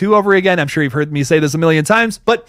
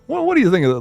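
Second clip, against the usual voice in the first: a hint of a Southern drawl on the question; light Southern drawl; 3 semitones higher, faster than usual. Shown as a demonstration. Streamer asking the chat again, in a light Southern drawl. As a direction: lean lightly into a Southern accent. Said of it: "put a little Southern drawl on my accent".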